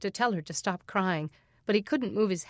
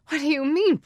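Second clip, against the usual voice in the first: higher, louder indignant outburst; 8 semitones higher, 7 dB louder than usual. Acted. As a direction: bristle at the question. Mother bristles at sheriff.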